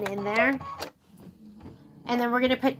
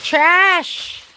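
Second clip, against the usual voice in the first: loud exclamation; 7 semitones higher, 7 dB louder than usual. Real, not acted. Tossing out a ruined piece.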